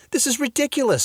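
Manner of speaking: high, indignant shout. A narrator's reading. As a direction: protest sharply. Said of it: "she shouted".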